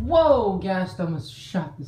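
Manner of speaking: sudden high loud whoa; startled whoa. Real, not acted. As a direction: jump at the fireball. Ghast attack surprise.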